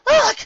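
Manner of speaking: loud, high frustrated ugh; a burst of pure frustration; frustrated grunt. A narrator's reading. Stuck in quicksand, frustrated.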